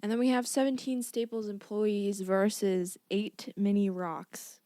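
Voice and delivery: lightly hyped, slow matchup announcement; show announcer. Acted. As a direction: present the battles like a lightly hammed-up fight announcer.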